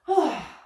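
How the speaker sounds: long audible exhale as she folds forward; exhale sigh. Shown as a demonstration. Teacher showing kids the exhale, breathy sigh. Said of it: "exhale and fold our front body over".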